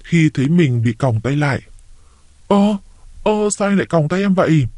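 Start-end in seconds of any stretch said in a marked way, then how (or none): 2.5-4.7 higher-pitched startled question; startled panic. A narrator's reading. My to Thức on finding herself handcuffed, startled. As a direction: voice lifts in alarmed confusion. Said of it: "My giật mình hốt hoảng".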